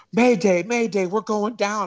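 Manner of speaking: high, urgent panicked mayday; mayday radio call. Retold or imitated. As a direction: cry it out like a pilot going down. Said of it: "the bus driver go".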